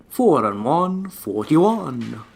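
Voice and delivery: slow drawn-out bingo call; bingo caller. Retold or imitated. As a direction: announce the number like bingo night. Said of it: "Sound like a fucking bingo caller".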